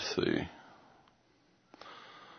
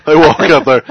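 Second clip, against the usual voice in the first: ordinary talk, nothing marked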